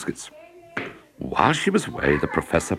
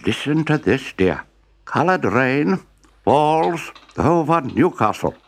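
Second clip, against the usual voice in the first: professor reading headline; 9 semitones higher, slower than usual. A narrator's reading.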